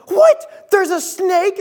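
high shrieking "What?" in mock panic; alarmed shout. Son imitates snake-hating mom hearing about the snake, shrieking. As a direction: imitate mom's horrified yell, pitch way up.

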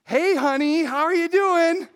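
high, loud, falsely cheerful greeting; husband faking breezy calm to his wife; fake casual. Shown as a demonstration. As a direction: act like nothing is wrong. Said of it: "And I went like this".

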